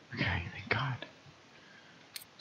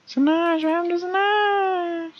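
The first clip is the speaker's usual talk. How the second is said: put-on high girl voice. Voicing the girl character while playing, high falsetto. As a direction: squeak the name out like a young girl. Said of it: "let me put on my best girl voice".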